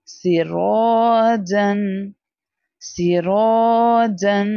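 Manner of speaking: सिरोजन slow, रो held long; stretched ro. Shown as a demonstration. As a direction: stretch the रो slowly. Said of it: "Again, अलिफ मद्दा". (सिरोजन में रो लंबा)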